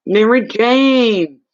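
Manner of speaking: high, slow, loud call of a name; stretched, delighted hello; drawn-out greeting. Real, not acted. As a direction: sing the name out slowly in welcome. Greeting a viewer in chat.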